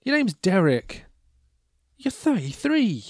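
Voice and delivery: high-pitched playful incredulity; mock disbelief. Host addressing young Derek's ad, mock disbelief.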